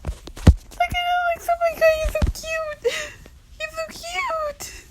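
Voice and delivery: very high, squeaky fangirl squeal; high-pitched gushing. Real, not acted. Fan gushing over a cute drawing, high squealing coo.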